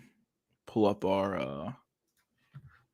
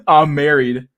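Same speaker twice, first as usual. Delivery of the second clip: incredulous reread; 3 semitones higher, 10 dB louder, slower than usual. Retold or imitated. Rereading through laughter.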